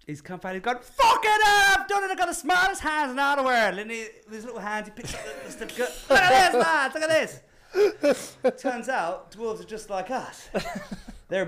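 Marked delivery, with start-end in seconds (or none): 0.8-3.8 high, loud mock-drunk jubilation; triumphant shout. Dwarf back with his certificate, hammed-up triumphant shout. 6.1-8.6 high, loud hammed-up crowing; triumphant shout. Dwarf showing lads his certificate, mock triumphant yell.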